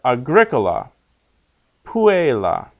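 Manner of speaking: aGRIcola, PUella, stressed third syllable from the end; antepenult stress. Shown as a demonstration. Teacher giving short-penult examples, agricola, puella stressed third from last. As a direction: hit GRI in agricola and PU in puella. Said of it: "they're accented on the antepenult".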